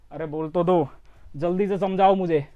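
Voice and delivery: ordinary talk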